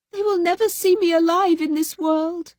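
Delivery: high tearful lament; tearful despair. A narrator's reading. Fatima weeping to her sister. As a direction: weep out the doom. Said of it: "sobbed Fatima".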